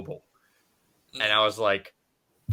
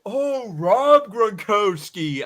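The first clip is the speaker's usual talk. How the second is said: put-on high, slow, dopey kid; dim kid voice; 10 semitones higher, 6 dB louder, much slower than usual. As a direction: drag it out like a clueless kid. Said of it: "And he was like".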